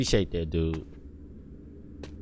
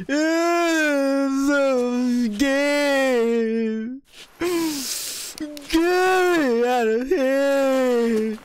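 high, long drawn-out mock wail; hammed-up crying; 9 semitones higher, much slower than usual. Scared player begging to get out, hammed-up sobbing wail. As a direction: wail it out slowly, stretching every word. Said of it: "why am I tearing up".

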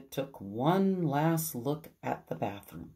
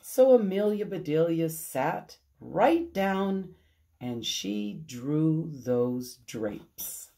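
plain reading voice

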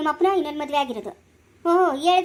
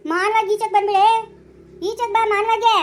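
raised, higher-pitched angry order; loud quarrelling; 5 semitones higher, 4 dB louder than usual. Acted. Mother-in-law ordering daughter-in-law out of the house, loud and angry. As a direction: order her out, voice climbing. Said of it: "ಇಷ್ಟು ಜೋರ್ ಜೋರಾಗಿ ಮಾತಾಡ್ತಾವಳೆ".

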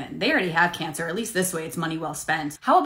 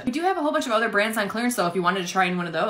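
ordinary delivery

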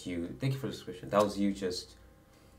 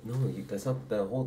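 ordinary talk

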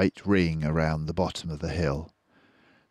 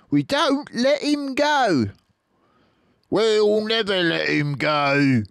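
slow, high, louder vow; high squeaky farmer; 7 dB louder than usual. Acted. Farmers to each other, slow squeaky vow.

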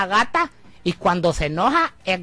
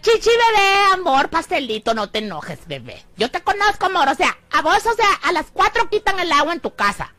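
high, sing-song mock sweetness; mock-sweet teasing of a friend; teasing coax; 6 semitones higher, 3 dB louder than usual. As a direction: coax her like a pouty baby.

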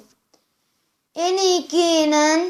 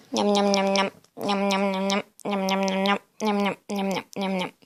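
soft munching noises; 5 semitones lower, 7 dB quieter than usual. Pretend. Dolls eat cake.

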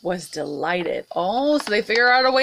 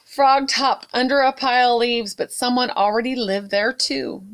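usual speaking voice

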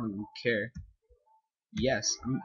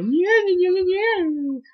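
excited cheer; 7 dB louder, slower than usual. Real, not acted. Cheering over rupees.

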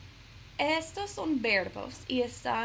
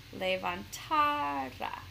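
levantará stretched slowly on TA, le-van-TAAA-ra; higher, drawn-out TA in levantará, weak final ra; énfasis en la penúltima; 4 semitones higher, much slower than usual. Shown as a demonstration. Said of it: "la penúltima sería ta". (levantará lento, alargando y acentuando la sílaba TA)

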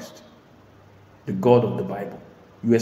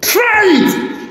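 much higher and louder, shouted dare; challenging shout; 9 dB louder than usual. Real, not acted. Preacher daring the congregation to test the word. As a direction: throw down the challenge.

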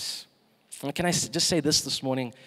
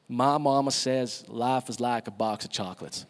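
put-on Forrest Gump's Southern US accent, a little lower; 3 semitones lower than usual. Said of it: "that's my best Southern accent".

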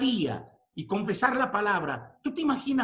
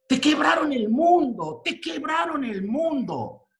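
forceful declaration; 6 semitones higher, 4 dB louder than usual. Real, not acted. Preacher crying out to the church, loud and forceful. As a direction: preach it high and loud, then slower.

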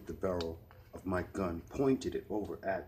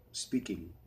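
usual speaking voice

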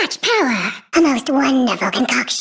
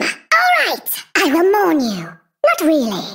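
ordinary talk, nothing marked

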